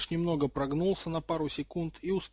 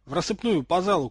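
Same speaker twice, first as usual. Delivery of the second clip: loud, raised battle call; shouted order; 4 semitones higher, 6 dB louder than usual. A narrator's reading. Harold orders his friends to scatter, shouting. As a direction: call out urgently across the hall. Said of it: "крикнул Гарольд".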